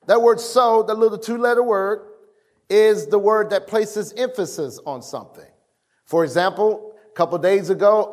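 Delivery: ordinary delivery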